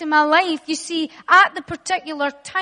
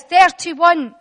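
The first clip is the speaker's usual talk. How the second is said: ordinary talk, nothing marked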